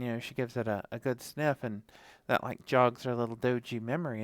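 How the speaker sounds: usual speaking voice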